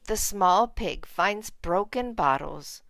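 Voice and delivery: usual speaking voice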